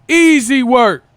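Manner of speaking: loud, high holler; catchphrase shout. Acted. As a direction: belt it out as a catchphrase. Calls out a catchphrase.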